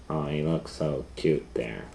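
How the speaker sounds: usual speaking voice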